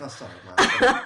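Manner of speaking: high, loud hammy apology; mock overacting. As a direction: overplay a sheepish son. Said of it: "with his stellar, uh, overacting".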